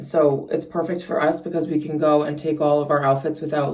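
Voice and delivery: ordinary talk, nothing marked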